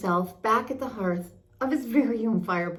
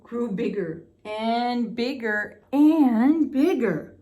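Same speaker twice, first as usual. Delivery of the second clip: rising to much higher and louder, slowing at the end; building, growing; 4 semitones higher, 5 dB louder, slower than usual. A narrator's reading. Narrator describing the monster growing, building to a loud peak. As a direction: let it swell bigger and bigger.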